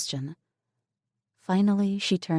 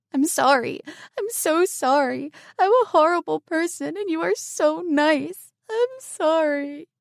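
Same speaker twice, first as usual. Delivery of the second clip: sobbing apology; 13 semitones higher, 5 dB louder than usual. Acted. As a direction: gasp out sorry through tears. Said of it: "Lisa broke down into sobs".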